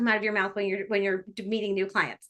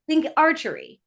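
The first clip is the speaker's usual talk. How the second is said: ordinary talk, nothing marked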